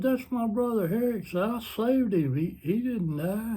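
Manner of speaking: high-pitched, mildly frantic protest; retold berserk outburst. George to guardian angel at the graveyard, berserk, high-pitched retelling. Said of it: "George goes berserk".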